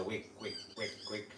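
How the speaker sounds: soft, short imitated chirps; baby soldier bird call. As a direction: mimic a chick with quiet little wicks. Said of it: "That's the soldier bird's baby".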